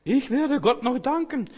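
high, loud proclaiming; preacher voicing the psalmist, high and loud; psalmist's vow. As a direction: lift the vow high and strong. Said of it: "So redet der Psalmist hier".

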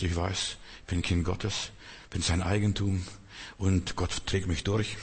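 leise, gelassen wie ein Gebet; ruhig vertrauensvoll. Retold or imitated. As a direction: sanft und zuversichtlich sprechen. (leise und gelassen, voller Vertrauen)

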